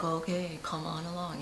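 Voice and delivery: soft, resigned acceptance; meek giving-in. Acted. Letting the critic tag along unchallenged, meek and quiet.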